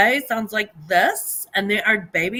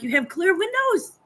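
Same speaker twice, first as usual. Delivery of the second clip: exasperated quote; 9 semitones higher than usual. Retold or imitated. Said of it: "but I'm like".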